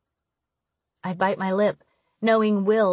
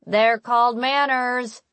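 slow, higher-pitched annoyed call; annoyed shout; 5 semitones higher, 4 dB louder than usual. A narrator's reading. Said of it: "annoyed voice shout down the hallway".

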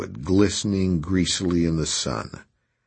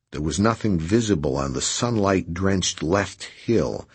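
plain reading voice

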